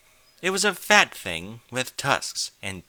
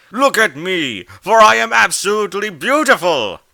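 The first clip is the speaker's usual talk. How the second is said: booming, high, grandiose creature voice; loud vain flying walrus; passionate walrus creature; 10 semitones higher, 12 dB louder than usual. A narrator's reading. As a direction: proclaim your beauty to the sky. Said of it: "spoke with a deep and passionate voice".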